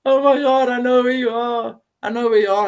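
high, loud, ecstatic revelation; overwhelmed with joy, shouting upward; ecstatic cry. Retold or imitated. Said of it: "And I'm like".

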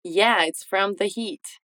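slow, falling whole sentence; slow model line. Shown as a demonstration. Teacher models the full answer slowly. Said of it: "Agora tudo junto".

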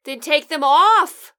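loud, drawn-out order; exasperated yell. Acted. Co-host to man under headphones, yelling. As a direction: yell it slowly so it gets through. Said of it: "There's no need to yell at me".

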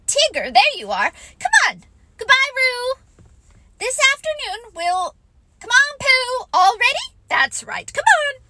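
high, hurried, stumbling bluster; flustered. Acted. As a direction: break off and cover up loudly. Rabbit flustered as Tigger arrives.

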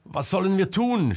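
high, loud cry for help; helpless shout at the fire; desperate shout. A narrator's reading. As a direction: scream it in despair. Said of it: "schrie Selma verzweifelt".

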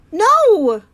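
sharp high cry of alarm; a girl shouts to stop Mac. A narrator's reading. Girl cries out to stop the man, loud alarmed shout. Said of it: "Julia cries".

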